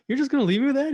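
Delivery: high, loud mock indignation; incredulous protest. Queen protests as husband walks off from game, indignant. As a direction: imitate an outraged, disbelieving question.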